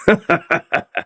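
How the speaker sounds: short high villain's laugh, falling away; gloating laugh. Acted. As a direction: laugh at how easily he fooled them. Villain gloats over hypnotized teacher.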